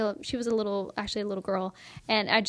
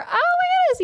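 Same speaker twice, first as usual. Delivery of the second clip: very high, louder gushing exclamation; gushing stranger; 5 dB louder than usual. Retold or imitated. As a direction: imitate someone squealing with delighted surprise. Retelling strangers' delighted reactions to name.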